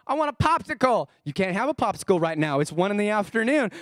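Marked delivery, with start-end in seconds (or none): 0.1-1.1 lightly voiced whiny child; a hint of a toddler begging; whining toddler. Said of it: "they want a pop".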